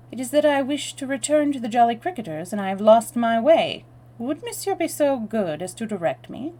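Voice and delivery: polite stranger with a hint of French; French accent. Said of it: "with a marked foreign accent".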